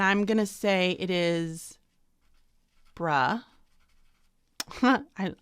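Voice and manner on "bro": "bruh" as the first syllable of brother; syllable sounded out. Shown as a demonstration. Teacher writes out the first syllable phonetically. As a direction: say "bruh" alone, clipped off. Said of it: "I love how I did that".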